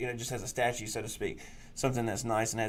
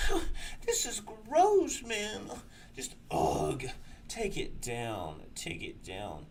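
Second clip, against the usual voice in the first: mocking disgusted cinephiles; 9 semitones higher, much slower than usual. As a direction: whine like a squeamish film snob. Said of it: "the pussies under me ... were like".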